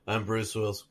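low, very soft action-star impression; Bruce Willis. Mocking the star's whole-movie delivery, low and quiet. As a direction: drop low and underplay it. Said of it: "Bruce Willis throughout the whole movie is like".

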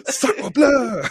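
high, loud mock French exclamation; French accent.

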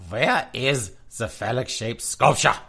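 mock stern German police interrogation; hammed-up German cop demanding answers. Host mocking German police hunting the statue, mock German interrogation. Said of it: "You see the Germans going after them".

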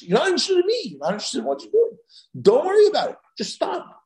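lightly voiced exasperated God, pitched high. God rebuking us for going through the motions, exasperated. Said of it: "that's what HaKadosh Baruch Hu is calling us".

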